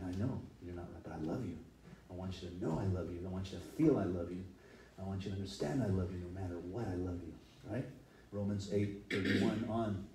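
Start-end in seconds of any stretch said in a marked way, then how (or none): 0.0-7.3 low, soft loving reassurance; tender God voice. Retold or imitated.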